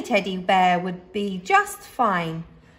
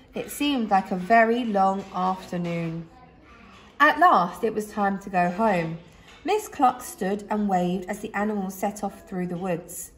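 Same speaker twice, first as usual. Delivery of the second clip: usual speaking voice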